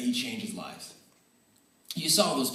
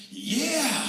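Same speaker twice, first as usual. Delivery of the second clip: high, bright burst of delight; excited cheer; 13 semitones higher, 4 dB louder than usual. Retold or imitated. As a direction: cheer like the coach seeing a great shot. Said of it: "he got so excited".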